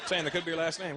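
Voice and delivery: ordinary delivery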